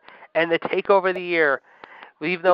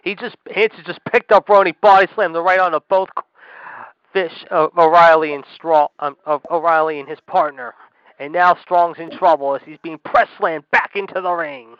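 loud, astonished wrestling call; excited play-by-play; 6 dB louder than usual. Real, not acted. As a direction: erupt at the big slam, then keep calling the action. Calls body slam live.

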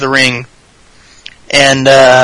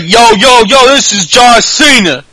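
higher, slangy mock Boston accent; John Cena Boston impression; 7 semitones higher than usual. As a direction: greet them like a cocky Boston guy. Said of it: "starts talking like he's from Boston".

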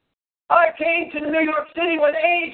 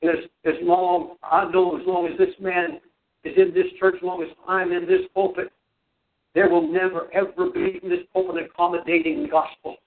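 preaching; 10 semitones lower than usual. Real, not acted. Preaching at full force.

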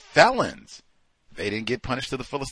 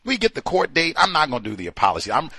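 ordinary delivery

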